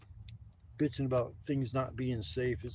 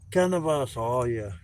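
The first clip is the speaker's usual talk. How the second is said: loud happy announcement; 10 dB louder than usual. Acted. Announcing his weed happily.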